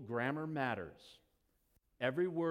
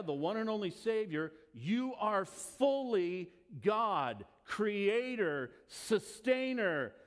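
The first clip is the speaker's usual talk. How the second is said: slow, emphatic, building proclamation; a rising list, peaking on speaker; emphatic preaching; 4 semitones higher, 4 dB louder, slower than usual. Acted. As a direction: proclaim each title of Christ. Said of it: "I get emphatic with you people".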